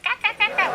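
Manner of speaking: high clucking cackle imitation; cackling hen. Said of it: "we call ourselves the cackling hens".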